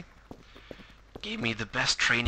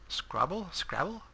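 puzzled repeat; 6 semitones higher, 5 dB quieter, slower than usual. Real, not acted. Puzzled by the odd game word.

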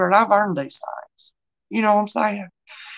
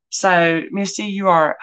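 ordinary talk, nothing marked

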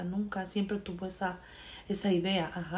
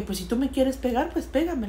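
higher, louder challenge in retelling; mock-confrontational retort, pitched up; defiant dare; 6 semitones higher, 4 dB louder than usual. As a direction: throw it back as a retold dare. Acted retort in retold quarrel.

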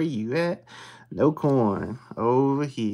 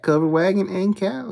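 usual speaking voice

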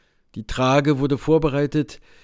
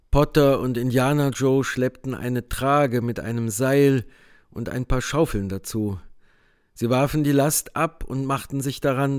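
usual speaking voice

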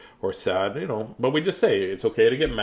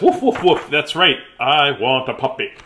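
put-on high, loud barky woofs for a child named Spencer; playful dog woof; 4 semitones higher, 8 dB louder than usual. Teacher voicing Spencer as a puppy, playful loud woofs. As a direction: bark it out like an eager puppy. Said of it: "Spencer says".

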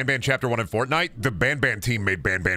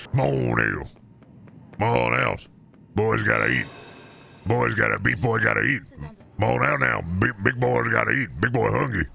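hungry monster voice. Acted. Growling monster voice luring prey.

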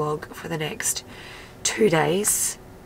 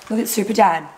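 playful teasing; 4 semitones higher, 6 dB louder than usual. Real, not acted.

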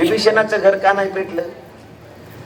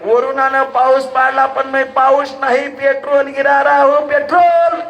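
gleeful reveal; 6 semitones higher than usual. Acted. Rain god telling Hanuman his trick, building to a shout.

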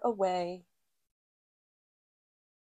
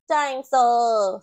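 put-on loud, slow toddler word; little George; 10 dB louder, slower than usual. A narrator's reading. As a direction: hug the word like a favourite toy. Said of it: "says George".

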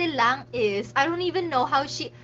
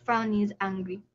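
ordinary talk

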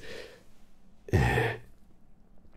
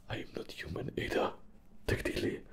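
whispered aside; 11 dB quieter, much faster than usual. Acted. Quick hushed aside.

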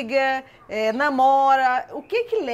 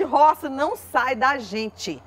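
ordinary talk, nothing marked

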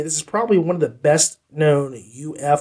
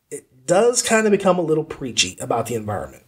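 ordinary delivery

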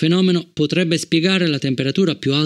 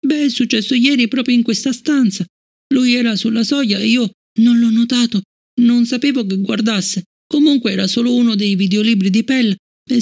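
put-on high girl's voice, a bit louder and insistent; a child protesting she did nothing wrong; child Fallon, defensive; 10 semitones higher, 3 dB louder than usual. Fallon defending herself to Bliss, high insistent child voice.

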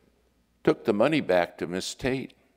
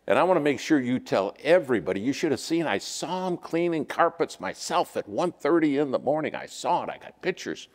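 fast, higher bragging voice, climbing; mock show-off; 5 semitones higher than usual. Pastor mocks showy service to the congregation, bragging higher and faster. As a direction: ham up a self-promoting servant begging for credit. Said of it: "can I tell you how I'd have done it".

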